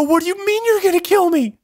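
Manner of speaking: shocked disbelief. Retold or imitated. Answering his friend's death threat in disbelief, high-pitched. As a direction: squeak it out like you can't believe it.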